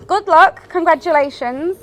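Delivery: ordinary talk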